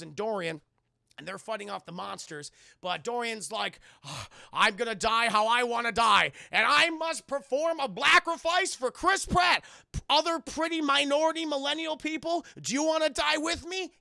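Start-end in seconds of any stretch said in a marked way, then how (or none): 4.0-13.9 loud, higher hammed-up hero speech; over-the-top loud rallying cry; heroic proclamation. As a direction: boom it out like a noble martyr. Dorian's heroic sacrifice speech.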